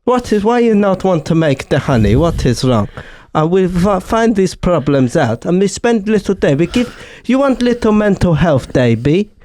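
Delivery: Greta impression slipping into comic Italian accent; hammy old-Italian-man accent; bad Italian-sounding accent. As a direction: coax in a broken-English Italian accent. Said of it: "Greta Thunberg is an old Italian man".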